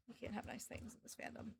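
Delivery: barely breathed, fading out; fainter whisper. Real, not acted. Streamer to chat, breathing the lament almost silently. Repeated, even quieter, unvoiced.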